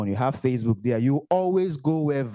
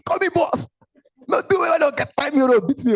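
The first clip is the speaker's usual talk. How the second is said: playful boss demand; 12 semitones higher, 4 dB louder than usual. Retold or imitated. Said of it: "My boss".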